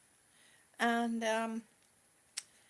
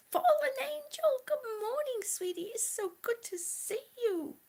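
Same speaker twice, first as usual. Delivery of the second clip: much higher, sweet greeting to a bird; high pet-talk voice; 12 semitones higher, 4 dB louder than usual. Pretend. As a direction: coo a bright hello to your pet.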